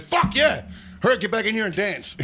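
high excited hurry-up shout; excited yell. Retold or imitated. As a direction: cheer him back to the dance floor. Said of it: "I'm like".

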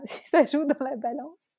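amused at her own hope; laughing. Real, not acted. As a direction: laugh while saying it. Laughs about her French accent.

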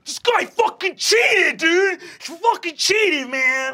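high, loud outraged yell of cheating; furious accusation. Acted. Half-orc jock yelling at the new kid that he cheated. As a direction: shout it like a sore loser who just got flattened. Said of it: "he looks up at you and says".

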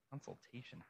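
very soft echo of the word; quiet little repeat to oneself; hushed aside. Shown as a demonstration. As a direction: repeat it under your breath. Quiet echo of word just read.

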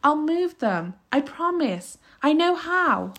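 put-on high boyish pleading; high-voiced anxious promises; begging. A narrator's reading. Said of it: "he begged".

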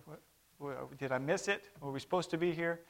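ordinary talk

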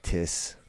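Tiss with a hard t, no th sound; imitating Scottish. Imitating a Scottish guest, Tiss for this. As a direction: drop the h, say Tiss. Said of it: "They don't pronounce the H".

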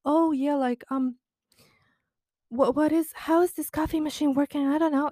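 high, airheaded playing-dumb voice; hammed-up helpless ditz, high and breathy-light; mock ditzy woman. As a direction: pitch up and act clueless. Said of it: "go to the lengths of playing".

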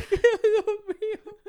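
gasping out the words through real laughter, high and slow; helpless laughter breaking every syllable; laughing hard. Real, not acted. Podcaster to his cohost, laughing at his dad's crying.

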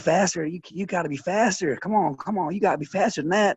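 high-pitched, insistent coach imitation; urging coach. Acts coach pushing wrestlers on.

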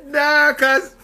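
hammed-up street reply to the Beast; shutting it down. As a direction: brush him off in a put-on high voice. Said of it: "You gotta say".

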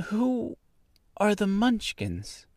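put-on very high girl's voice; curious young girl. A narrator's reading. Girl asks the woman about the Munchkins, curious. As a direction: ask innocently, high in pitch.

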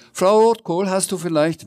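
high, loud call easing off into a question. A narrator's reading. Sams bursts into Frau Rotkohl's kitchen, calling out. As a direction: call her name eagerly from the doorway.

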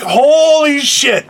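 slow, high, drawn-out disbelief; stunned exclamation. Retold or imitated. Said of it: "I just said".

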